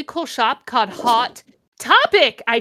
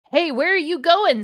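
higher, louder calling-after; mock high call; 8 semitones higher, 5 dB louder than usual. A narrator's reading. Satan calling after the falling heroine, higher and louder. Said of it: "Satan asked as I fell".

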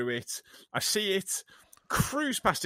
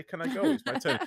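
politely asking for a turn, higher pitched; mock polite; 10 semitones higher than usual. Podcast host imitating a polite hand-raiser, mock polite. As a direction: put your hand up sweetly, lightly mocking. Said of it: "I like the politeness of that".

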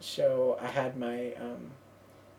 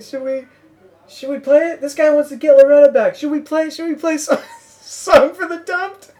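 high, loud stage voice; imitated crowd-rousing question; 11 semitones higher, 12 dB louder than usual. Singer asking the crowd about a request, loud retold question. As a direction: put it to the audience, laughingly.

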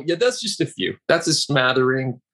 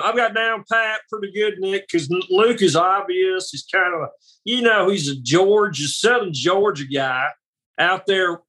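[name] impression; 5 semitones higher than usual. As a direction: slip into a Southern Georgia accent like [name]. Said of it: "do my impersonation of them".

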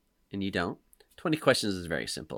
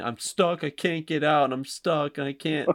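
higher, pleading cries of being stuck; son's night-terror panic, higher and louder; imitating panicked kid; 6 semitones higher, 3 dB louder, slower than usual. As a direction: mimic a sleepwalking child in panic. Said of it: "he's just going".

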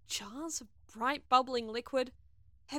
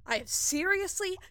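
raised, higher, urgent voice; heated insistence; 5 semitones higher, 10 dB louder than usual. Acted. Crewmate insists on delivering the fleece, heated. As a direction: press the point hard and loud.

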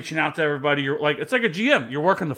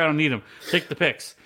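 normal talking voice

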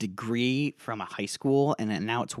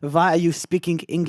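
higher mock French accent; 4 semitones higher, 4 dB louder than usual. French locals questioning a visitor, mock French accent. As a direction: challenge in a fake French accent. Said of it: "I also have my French accent".

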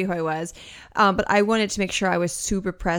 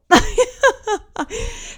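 laugh; 7 dB louder than usual. Real, not acted. Laughs after sharing soul.

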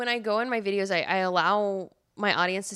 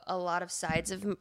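ordinary talk

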